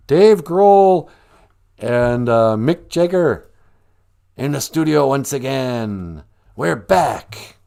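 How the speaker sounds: loud, pushed-up showbiz excitement; hammed-up rock comeback announcement; mock hype announcer. Announcing rock veterans back in the studio, mock-excited.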